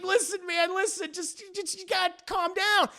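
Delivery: high, halting appeal; mock nervous pleading. Dwarf at gunpoint calming husband, acted nervous pleading. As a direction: stammer through a squeaked plea.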